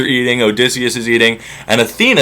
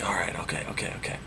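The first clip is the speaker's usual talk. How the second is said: cowed whisper; 8 semitones lower, 21 dB quieter, faster than usual. Acted. Servant girl cowed into silence.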